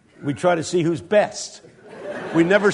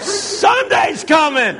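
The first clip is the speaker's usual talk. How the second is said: high, slow, stretched preacher's shout; shouted refrain; 8 semitones higher, slower than usual. Retold or imitated. Preacher answers his own Friday line, slow high shout. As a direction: belt it out like a revival preacher.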